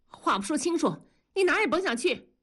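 high-pitched, heated refusal; defiant. A narrator's reading. Wife blocks husband's way out, defiant. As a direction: block them with a quick, high retort.

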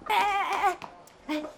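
high, insistent string of calls; calling him back. Acted. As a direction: stop someone walking off. Calls after him as he leaves. (急忙高声叫住对方)

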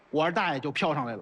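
normal talking voice

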